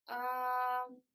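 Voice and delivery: long, neutral uh sound on "um"; a drawn-out, relaxed middle vowel "um"; schwa sound. Shown as a demonstration. Teacher showing students the schwa sound, neutral hesitation uh. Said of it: "it sounds like".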